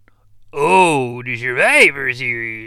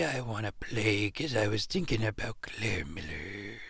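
secret whisper; 3 semitones lower, 14 dB quieter than usual. Acted. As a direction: hush it like a confession. Confiding aside to Johnny.

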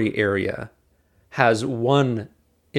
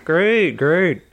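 through gritted teeth; 5 semitones higher than usual. Retold or imitated. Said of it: "Can imagine through clenched teeth".

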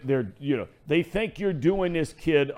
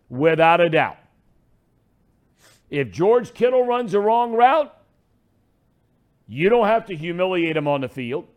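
ordinary delivery

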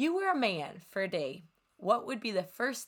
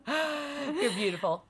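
laughing; 7 semitones higher, slower than usual. Real, not acted. Laughing through the words.

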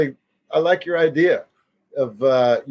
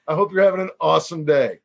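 ordinary talk

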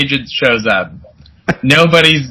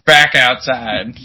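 normal talking voice

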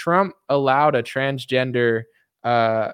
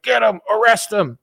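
mock outrage; 8 semitones higher, 4 dB louder than usual. Mimicking outraged liberals.

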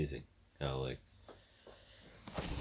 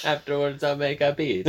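put-on Italian accent; 10 semitones higher, 8 dB louder than usual. Friend adding to the Italian plumber joke, high hammy accent.

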